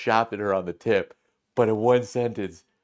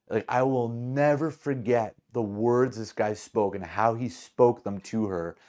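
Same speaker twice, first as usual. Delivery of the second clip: usual speaking voice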